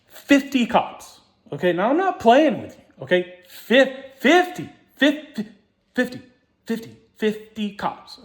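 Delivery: high, slow, hammering the word fifty; insistent emphasis. Real, not acted. Telling listeners how many cops came, insistent and emphatic.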